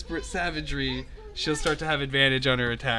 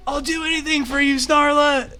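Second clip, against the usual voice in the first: high, loud hammy vow; brave cry; 14 semitones higher, 8 dB louder than usual. Acted. Companion charges with a long sword, brave high shout. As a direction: shout like a lovesick hero. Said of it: "in his bravery".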